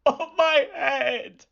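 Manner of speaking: high, slow, wailing wolf voice; wolf wails as the basket hits him; wolf's pained cry. A narrator's reading. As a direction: drag it out like a hurt, indignant wolf.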